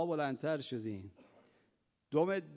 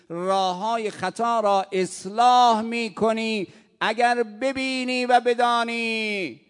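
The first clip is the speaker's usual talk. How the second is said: sermon climax; 7 semitones higher, 12 dB louder than usual. Real, not acted. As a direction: swell from firm to a full cry. Preaching climax.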